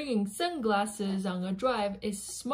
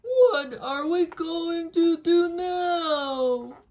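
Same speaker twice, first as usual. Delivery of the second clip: higher, louder and slow, wailing; dramatic despair; 6 semitones higher, 5 dB louder, slower than usual. Acted. Elephant despairing to Piggie over ruined plans, slow loud wail. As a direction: wail it like all is lost.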